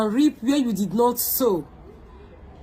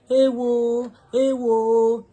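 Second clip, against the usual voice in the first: mock wailing cry; 3 semitones higher, 4 dB louder, much slower than usual. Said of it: "Come say".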